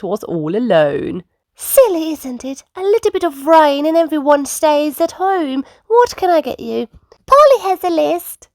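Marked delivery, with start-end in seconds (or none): 1.5-6.9 high chatty shopkeeper; Miss Rabbit. A narrator's reading. Shopkeeper alone in supermarket, high chatty voice. 7.3-8.5 high, proud piglet voice; proud Peppa. A narrator's reading. Peppa to the shopkeeper, high proud child. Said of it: "Peppa said proudly".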